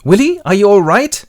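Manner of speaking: high, loud wifely call; acted concerned wife. Linda checks on Willie as he gets home, acted concern. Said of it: "Linda hears him and goes".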